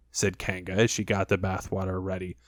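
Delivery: ordinary delivery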